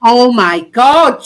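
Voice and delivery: loud, slow exasperated groan; drawn-out loud exasperation. Real, not acted. Exasperated outburst in argument.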